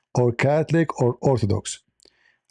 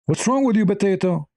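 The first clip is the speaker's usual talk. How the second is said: exasperated; 7 semitones higher than usual. Real, not acted. Exasperated at commenter.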